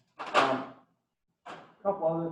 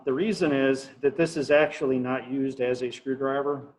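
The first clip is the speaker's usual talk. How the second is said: ordinary talk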